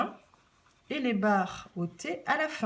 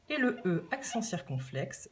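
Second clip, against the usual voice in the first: usual speaking voice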